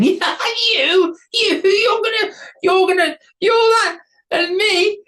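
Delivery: very high, loud squeaky voice; mocking troll. A narrator's reading. Troll laughing at the tiny warrior, high squeaky mockery. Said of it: "burst out laughing".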